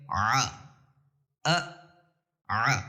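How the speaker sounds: ع pressed from the throat, ا as a clean glottal stop; throat ain and hamza. Shown as a demonstration. Teacher contrasting throaty ع with hamza ا. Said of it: "حلق کے انتہا سے ادا ہوتا ہے".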